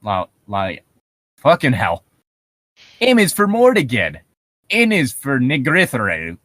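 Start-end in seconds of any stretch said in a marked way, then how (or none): none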